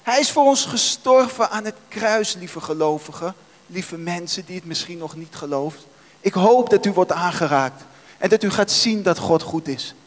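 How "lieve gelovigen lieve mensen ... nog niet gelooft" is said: soft, lowered voice to the listeners; tender address. Real, not acted. Preacher to dear believers and unbelievers, tender. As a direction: drop right down and speak gently.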